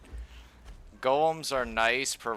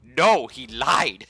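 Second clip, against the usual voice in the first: loud, slow shouted denial; golem yelling; 4 semitones higher, 12 dB louder, much slower than usual. Retold or imitated. Golem yelling at the player that the thrall lied. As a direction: yell it like a cornered golem. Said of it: "It's just like".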